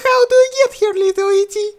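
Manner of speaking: very high, loud baby-talk mockery; mocking princess. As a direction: tease him like a child. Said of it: "mocked him the princess".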